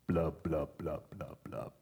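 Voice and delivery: low, fading mock sinking gurgle; sinking blub noises. Pastor acting Peter sinking, low fading blub sounds. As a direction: gurgle it as Peter goes under.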